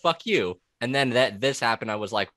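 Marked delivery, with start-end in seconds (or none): 0.0-0.6 retold angry comeback. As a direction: fire it back like you're offended, replaying it. Retelling angry retort to poster.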